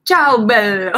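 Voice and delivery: loud, playful ciao bello with a mock Italian lilt; ciao bello called out in hammed-up Italian; mock Italian greeting. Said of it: "I'm speaking too many languages".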